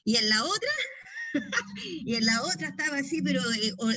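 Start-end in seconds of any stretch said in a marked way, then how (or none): 0.7-2.0 burst of laughter. Real, not acted. Laughs while recalling deliverance.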